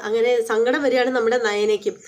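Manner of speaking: ordinary delivery, nothing marked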